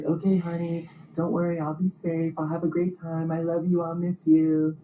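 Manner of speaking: high, sing-song sweet-talking wife; sugary wife voice. Retold or imitated. Wife cooing goodbye to her husband, high and sugary. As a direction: coo it like a tipsy wife saying goodbye. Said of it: "she's like".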